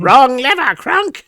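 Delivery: high, loud cartoon villainess; Yzma. Co-host quoting the film villain, high voice. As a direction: screech it like an exasperated villainess. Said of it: "From Emperor's New Groove".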